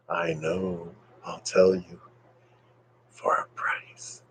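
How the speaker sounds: hushed, low mock-secret offer; conspiratorial whisper. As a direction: drop to a teasing whisper, as if sharing a secret. Furtive teasing offer to Sabina.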